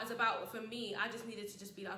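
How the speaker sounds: ordinary delivery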